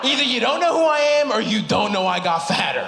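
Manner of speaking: incredulous comeback to a confused grandma; exasperated. Pretend. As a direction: throw up your hands at her, laughing.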